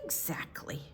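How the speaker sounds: breathy hushed agreement; just breathe it; whisper. Real, not acted. Grandma agrees with the child in a whisper.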